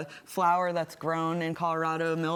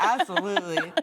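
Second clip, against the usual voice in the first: laughing through the word, louder; 2 semitones higher, 4 dB louder than usual. Real, not acted. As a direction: chuckle it out happily. Agreeing while laughing.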